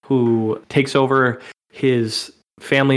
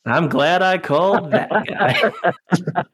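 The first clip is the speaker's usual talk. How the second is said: laughing quote; 5 semitones higher, much slower than usual. Retold or imitated. Repeats the quote slowly, laughing.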